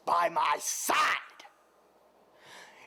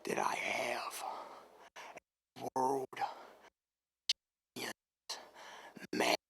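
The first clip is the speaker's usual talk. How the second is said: menacing whisper; 5 semitones lower, 9 dB quieter than usual. Acted.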